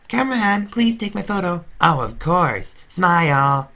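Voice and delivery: photo-hungry diva. Acted. Fake sister demanding her photo from the cameraman, loud and high. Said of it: "All that fake Glaceon cared about was photo time".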